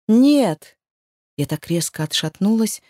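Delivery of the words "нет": loud, high cry; scream of denial. Acted. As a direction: shout it in horror. Героиня в ужасе кричит. (крик отчаяния)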